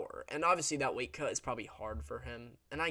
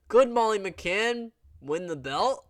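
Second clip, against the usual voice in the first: mocking hyped fans; 8 semitones higher, 9 dB louder, slower than usual. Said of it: "Remember when people were like".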